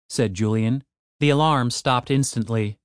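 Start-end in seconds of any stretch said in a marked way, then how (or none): none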